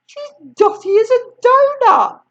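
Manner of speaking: high, loud exasperated remark; boy's exasperated thought. Acted. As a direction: think it in disbelief. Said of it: "George thought".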